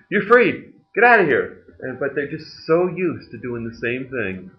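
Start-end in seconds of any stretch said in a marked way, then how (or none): 0.1-1.6 mock shout. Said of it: "It's like".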